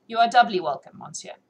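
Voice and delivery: ordinary delivery, nothing marked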